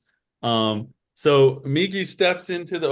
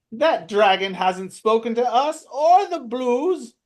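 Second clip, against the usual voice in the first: high squeaky kobold arguing; skeptical kobold; 3 dB louder than usual. Acted. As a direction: argue it like a clever little kobold. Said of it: "the one that saw it was an illusion is like".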